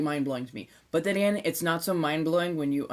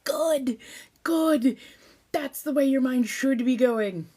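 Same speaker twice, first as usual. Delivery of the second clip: high, loud eager approval; bright burst of approval; excited praise; 9 semitones higher, 5 dB louder, slower than usual. Real, not acted. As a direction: cheer them on. Cheering on a chat comment.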